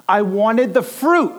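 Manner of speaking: high, loud, slow whine; whiny toddler. Retold or imitated. Toddler complaining to dad about the fries, high loud whine. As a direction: stretch it out like a sulking three-year-old.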